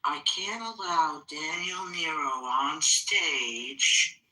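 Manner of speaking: very slow, drawn-out mock-calm announcement; imitated calm voice. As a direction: imitate someone calmly refusing, stretching every word. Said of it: "said it in his calm voice".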